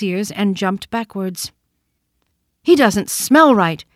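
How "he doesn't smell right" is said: louder, slow startled exclamation; exclaimed. A narrator's reading. Wild rabbit exclaiming after sniffing the toy rabbit, louder. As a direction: recoil and blurt it out. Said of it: "he exclaimed".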